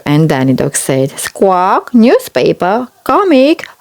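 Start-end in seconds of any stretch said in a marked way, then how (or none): 1.2-3.7 parrot voice. Acted. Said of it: "Polly Parrot copied".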